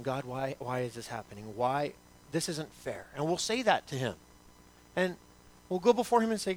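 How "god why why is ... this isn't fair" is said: much softer, hushed plea to God; hushed prayer. Acted. Believer quietly asks God why, in a hushed voice. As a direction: breathe it like a private, wounded prayer.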